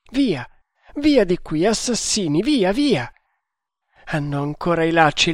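agitated raving, voice climbing in bursts; delirious alarm. Acted. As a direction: drive off imagined assassins, frantic. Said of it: "gli occhi stralunati".

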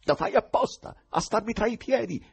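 high, exasperated angry cry; infuriated shout. A narrator's reading. Ivan blocked at every step by the choirmaster, infuriated. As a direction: shout at him, voice shot up in fury. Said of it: "gridò Ivan infuriandosi".

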